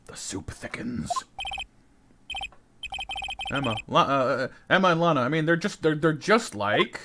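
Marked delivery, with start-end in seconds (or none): 0.0-1.1 soft, slow whisper; whispered joke. Acted. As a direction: whisper it as a deadpan aside.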